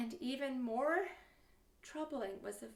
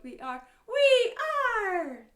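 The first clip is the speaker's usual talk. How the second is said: loud, high final shout, drawn out; long rallying sing-song shout; 9 semitones higher, 15 dB louder, slower than usual. Shown as a demonstration. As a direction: yell it big to finish the song.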